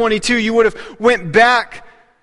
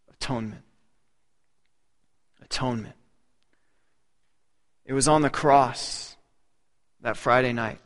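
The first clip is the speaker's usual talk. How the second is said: hushed solemn weight; 8 semitones lower, 8 dB quieter than usual. Real, not acted. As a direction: drop to a grave hush. Climax on atonement, hushed.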